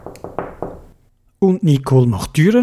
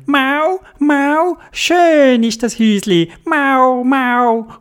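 much higher delighted cat; a kitten thrilled with its house; high cheerful meowing cat; happy cat voice; 9 semitones higher, slower than usual. Acted.

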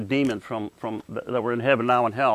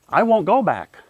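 higher-pitched, insistent refusal; defiant refusal; 5 semitones higher than usual. Retold or imitated. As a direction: dig in and refuse. Defiant refusal retold to God.

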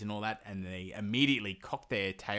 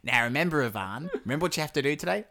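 nagging witch; 5 semitones higher, 5 dB louder, faster than usual. Pretend. Witch nagging Ivan like a child.

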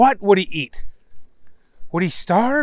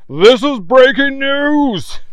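slow, loud newsman announcement; news reader; 8 semitones higher, 6 dB louder, slower than usual. A narrator's reading. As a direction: announce it like a TV anchor. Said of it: "said the newsman".

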